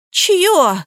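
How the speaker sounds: high, sharp question; startled demand. A narrator's reading. Liza questions the marriage certificate, startled.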